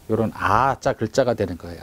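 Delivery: ordinary talk, nothing marked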